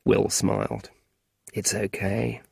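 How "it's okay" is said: much softer, tender reassurance; gentle. Acted. Will gently comforting Ally, soft and tender. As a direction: soothe the upset boy softly. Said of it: "he said gently".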